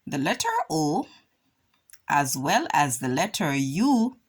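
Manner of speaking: ordinary talk, nothing marked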